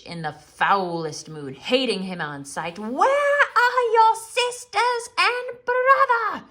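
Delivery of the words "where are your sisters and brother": very high, loud, slow furious snap; witch snapping. A narrator's reading. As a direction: bite each word out. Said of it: "she snapped".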